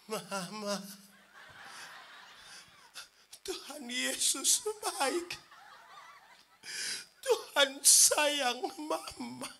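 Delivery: slow, tender dying husband's voice; drawn-out sweet words to his wife; sudden tenderness. Retold or imitated. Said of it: "baru dia bilang".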